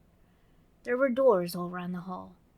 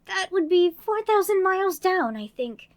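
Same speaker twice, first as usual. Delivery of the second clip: put-on high, bright little girl voice; Alice voice; 11 semitones higher, 11 dB louder than usual. Narrator voicing Alice, girlish and eager. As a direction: play young Alice talking to herself.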